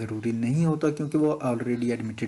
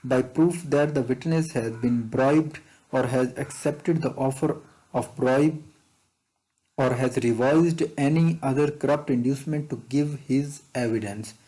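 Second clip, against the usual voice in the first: slow question reading; much slower than usual. Shown as a demonstration. Reads exam question text aloud.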